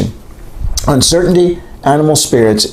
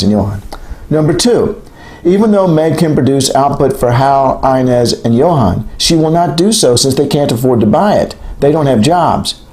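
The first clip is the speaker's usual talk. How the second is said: ordinary delivery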